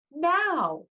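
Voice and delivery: high, urgent cry; Annabeth yelling. A narrator's reading. Girl urging her friend off the bus, urgent yell. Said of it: "Annabeth yelled at me".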